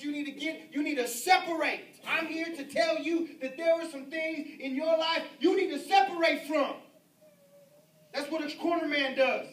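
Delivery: loud, rising pulpit shout; fiery preacher climax; preaching at full force. Real, not acted. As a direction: hammer it home to the congregation. Preacher drives the point home.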